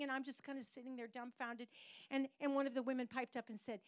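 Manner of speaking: usual speaking voice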